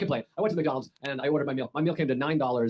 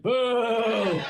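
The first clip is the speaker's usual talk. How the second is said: higher, jeering boo call; mock boo; 8 semitones higher than usual. A heckler booing someone who quit drinking, mock jeer. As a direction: heckle it like a drunk crowd.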